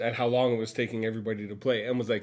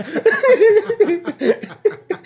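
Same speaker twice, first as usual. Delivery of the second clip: loud high laughing fit; 9 dB louder than usual. Real, not acted. Cracking up at the punchline.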